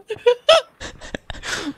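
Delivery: squealing laughter; high wheezing laugh. Real, not acted. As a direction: laugh helplessly. Laughing hard.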